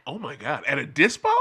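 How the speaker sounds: high, loud incredulous question; stunned, rising outburst; shocked disbelief. Real, not acted. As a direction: react in appalled disbelief, voice jumping up. Shocked at coworker's treatment.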